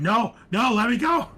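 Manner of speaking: loud, high panicked yell; screaming captive. Acted. Captive screams at the cultists to let him go. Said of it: "like, screaming".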